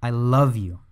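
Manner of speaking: "love" pushed, falling after it; "love" carries the stress, "you" drops. Shown as a demonstration. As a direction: lean into "love". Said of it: "emphasize each different word".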